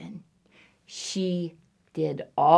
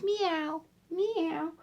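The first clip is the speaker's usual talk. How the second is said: high, soft little meows; small high-pitched meows; cat meow; 9 semitones higher, 10 dB quieter than usual. Acted. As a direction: mew softly like a kitten. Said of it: "Something goes".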